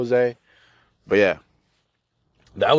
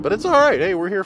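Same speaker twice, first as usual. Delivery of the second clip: forced cheer; 10 semitones higher, 4 dB louder, faster than usual. Real, not acted. Forced cheer about early shift.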